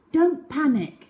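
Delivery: put-on boy urging calm; Algy's urgent line. A narrator's reading. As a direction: tell them not to panic, a bit pressed. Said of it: "said Algy".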